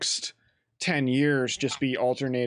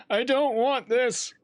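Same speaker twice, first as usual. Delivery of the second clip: high, slow wailing mock protest; mock ghost plea; 12 semitones higher, slower than usual. Co-host joining the ghost bit, high mock wail. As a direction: whine it out like a helpless ghost. Joins in as pleading ghost [name].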